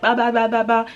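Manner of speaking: high, loud babbling ba, ba, ba; hammed-up loud chatter down a phone; mock loud phone talk. Mocking how Nigerians supposedly talk on the phone, high and loud. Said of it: "they shout when they talk".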